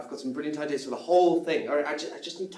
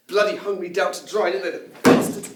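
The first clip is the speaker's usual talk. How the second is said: bitter; 5 semitones higher, 9 dB louder than usual. Acted. Bitter outburst about MI6.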